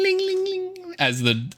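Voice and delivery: high sung ding-a-ling; door chime. Acted. GM imitating the shop door bell, high chime.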